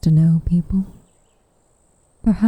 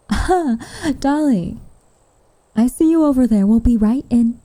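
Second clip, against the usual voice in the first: higher, carrying call to someone across the way; raised, higher hail to a friend at a distance; 7 semitones higher than usual. Acted. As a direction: call out to Dolly, suddenly sociable. Calls out to Dolly nearby.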